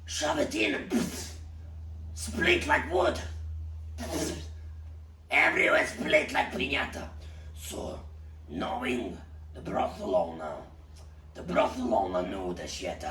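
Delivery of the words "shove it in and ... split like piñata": excited gory climax. Acted.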